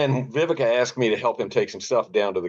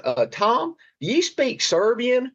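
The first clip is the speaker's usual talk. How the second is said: much higher-pitched caller voice; imitating his agent; slower than usual. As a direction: mimic an agent asking, pitched way up. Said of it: "she called me up and she goes".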